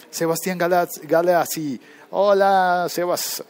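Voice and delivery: loud, high greeting to a listener; excited shout-out. Real, not acted. Host spotting a listener in the chat, loud happy hello. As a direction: call out his name with delight.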